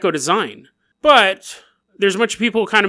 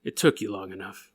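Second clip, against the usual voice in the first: a low, softer deadpan remark; low dry quip; 11 semitones lower, 10 dB quieter than usual. Acted. Waiting man with crutch greets the players, low deadpan. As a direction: drop the voice, unimpressed.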